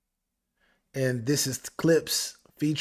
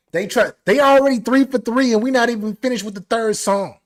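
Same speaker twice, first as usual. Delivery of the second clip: higher, louder hype; excited hype; 7 semitones higher, 7 dB louder than usual. Real, not acted. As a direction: pump up the chat. Hyped praise.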